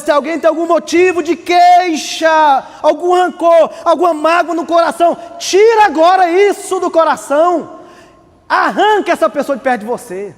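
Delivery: shouting preacher; fiery preaching. Acted. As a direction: preach it hard, rising up. Preaching at full force.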